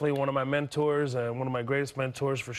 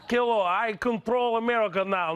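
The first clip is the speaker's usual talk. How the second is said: higher-pitched mock Russian accent; 7 semitones higher than usual. Comedian claiming control of America, mock Russian accent. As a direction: put on a boastful Russian accent.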